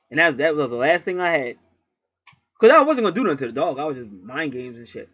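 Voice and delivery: plain reading voice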